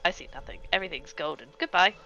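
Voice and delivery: usual speaking voice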